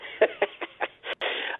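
short breathy laugh. Real, not acted. Restaurant owner reacting to the host's tax question, laughing. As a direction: laugh under the breath before answering.

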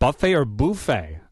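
first "buffet" as buh-fay, second "buffet" as boo-fay; two pronunciations of buffet. Shown as a demonstration. Host echoes the caller's question, "buffet" as buh-fay, then boo-fay. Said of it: "What do you say?".

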